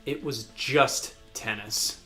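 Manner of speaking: ordinary talk, nothing marked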